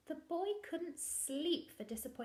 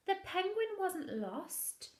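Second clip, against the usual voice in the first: revelation; 8 semitones higher than usual. A narrator's reading. Story's turning point revealed.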